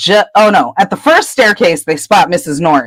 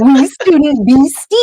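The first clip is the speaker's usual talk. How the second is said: sing-song Peeves poltergeist; 8 semitones higher than usual. Retold or imitated. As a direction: tease it out like a poltergeist playing hide and seek. Peeves calling into dark corridor.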